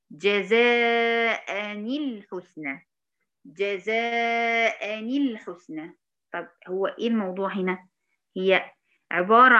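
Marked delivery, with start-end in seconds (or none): 0.1-2.9 جزاء الحسنى drawn out, linked as "jazā'ani-l-ḥusnā"; slow tajweed example. Shown as a demonstration. Teacher gives a tanween example, slow recitation. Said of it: "زي مثلًا كلمة". 3.5-6.0 جزاء الحسنى very drawn out, "jazā'ani-l-ḥusnā"; slow tajweed recitation. Shown as a demonstration. Teacher repeats the example for students, stretched recitation.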